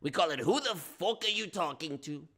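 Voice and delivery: lightly voiced De Niro tough guy; a hint of mobster swagger; New York accent.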